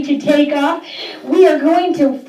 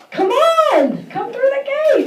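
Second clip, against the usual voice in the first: excited calling; 10 semitones higher than usual. Pretend. Calling kids through pretend gate.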